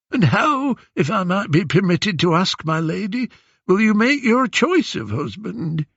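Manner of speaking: put-on high, humbly probing old beggar voice; old man's accented whine. Disguised Odysseus asking the queen about her choice, old man's whine. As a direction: ask deferentially in a foreign old man's whine. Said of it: "old man's hoarse whine".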